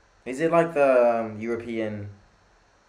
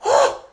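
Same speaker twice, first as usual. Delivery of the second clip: loud, high gasp of amazement; shocked gasp; 10 dB louder than usual. Real, not acted. Fan stunned by a shot, shocked gasp.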